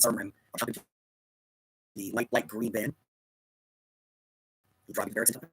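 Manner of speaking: ordinary talk, nothing marked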